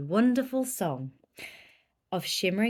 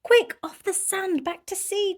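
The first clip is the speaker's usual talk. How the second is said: much higher, urgent plea; urgent cry; 11 semitones higher, 4 dB louder than usual. Acted. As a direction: cry out in a panic. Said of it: "cried the snail".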